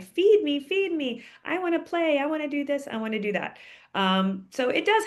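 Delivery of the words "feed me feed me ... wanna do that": high, pleading cat voice, easing down at the end; mock howling cat. Voicing cats howling early in the morning, playful mock cry. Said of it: "they are howling, crying".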